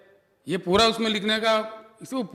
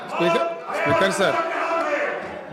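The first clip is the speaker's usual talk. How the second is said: drawn-out high shout for the Speaker; slow, raised call over the noise; 7 semitones higher, much slower than usual. Real, not acted. Calling the Speaker over uproar.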